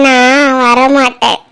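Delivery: low, slow refusal; coy refusal. Acted. Girl refuses uncle's call to come closer, low coy refusal. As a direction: drop low and draw out the no.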